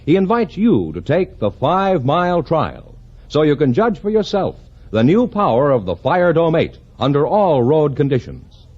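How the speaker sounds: ordinary talk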